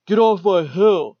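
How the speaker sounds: high, loud mock bully shout; hammed-up bully taunt; bratty bully yell. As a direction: bark it like a kid guarding his turf. Bully shouting at kids sledding.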